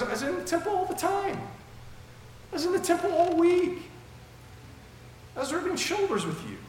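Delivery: very high-pitched, hammed-up protest; Jesus. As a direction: plead the obvious in a squeaky, incredulous voice. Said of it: "Jesus goes".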